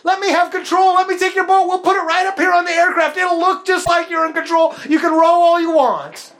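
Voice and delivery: high, loud jolly sailor's offer with a laugh; playful booming ship's greeting; hammed-up jolly captain. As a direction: hail the rower warmly from the deck. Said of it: "and the Lord says".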